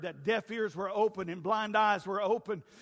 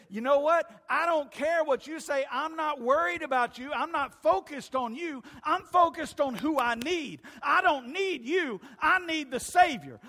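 loud, high, driving declaration; defiant shout; 5 semitones higher, 6 dB louder than usual. Acted. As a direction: throw it back at the crowd at full voice. Beggar defiantly answers the crowd.

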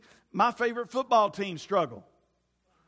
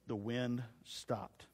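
hushed; 8 semitones lower, 11 dB quieter, much slower than usual. Acted. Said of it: "dramatic hush at story end".

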